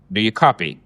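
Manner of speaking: usual speaking voice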